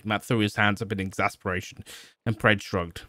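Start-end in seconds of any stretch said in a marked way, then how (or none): none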